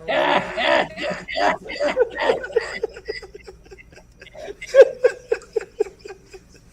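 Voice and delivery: loud, high burst of laughter; big laugh. Real, not acted. Teller cracks up after his horse joke, loud high laughing burst. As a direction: roar with laughter at the punchline.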